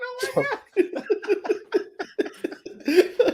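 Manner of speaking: laughing. Real, not acted. Laughing at head bump.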